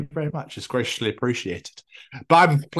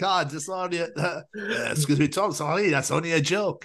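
put-on higher, halting French-accented voice; hesitant mock French accent, apologetic; imitating French accent; 8 semitones higher than usual. Belgian guy excusing his joke to Tom, higher French-accented imitation. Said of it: "he had a French accent".

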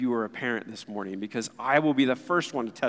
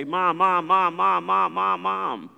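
put-on a pestering kid calling for mom, higher and louder; nagging child; 5 semitones higher, 5 dB louder, slower than usual. Preacher imitating a child pestering mom, higher and louder. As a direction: call out like a child tugging at a sleeve. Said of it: "to bug you, to say".

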